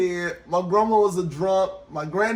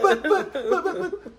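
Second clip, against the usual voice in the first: high, laughing stammer of excuses; whiny stammering; 12 semitones higher than usual. Retold or imitated. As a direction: sputter "but" like a cornered patient. Mocking patient stammering excuses.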